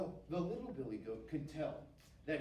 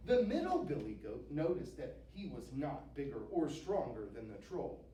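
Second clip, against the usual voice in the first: ordinary delivery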